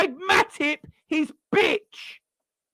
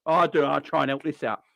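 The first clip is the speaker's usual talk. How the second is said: mocking Matip; faster than usual. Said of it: "Matip, where are you going?".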